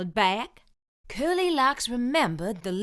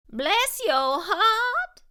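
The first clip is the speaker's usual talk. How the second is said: much higher, slow fussy innkeeper; innkeeper's voice; 10 semitones higher, much slower than usual. A narrator's reading. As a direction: coo it slowly like a fretting innkeeper. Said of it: "said the innkeeper".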